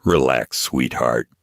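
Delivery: a hint of a lazy menacing drawl. Tattooed thug mocks Naomi, a light lazy drawl. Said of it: "the tattooed man drawled".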